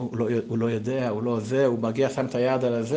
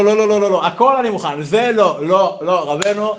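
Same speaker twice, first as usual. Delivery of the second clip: louder, higher string of no's; emphatic refusal; 7 semitones higher, 7 dB louder than usual. Acted. Yitzchak refusing to go to Dorna again, loud and high. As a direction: refuse loudly over and over. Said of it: "והוא אומר לו".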